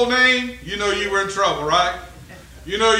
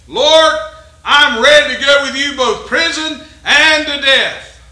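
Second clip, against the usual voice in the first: loud, higher, slow eager vow; eager Peter; 4 semitones higher, 7 dB louder, slower than usual. Acted.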